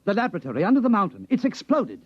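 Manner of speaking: high and quick, bursting with news; officer breathless with news of the explosion; excited. Acted. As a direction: rush the news out, thrilled.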